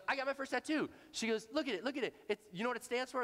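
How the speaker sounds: bubbling over about her new tattoo; excited mom. Retold or imitated.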